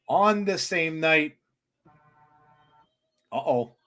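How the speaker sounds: ordinary delivery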